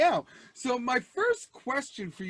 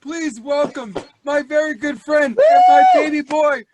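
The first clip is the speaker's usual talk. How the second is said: shouted announcer welcome, much louder and higher; roaring the guest's name to the crowd; hype introduction; 8 semitones higher, 13 dB louder than usual. Acted. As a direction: bring him on like a boxing announcer. Host introducing the guest comedian.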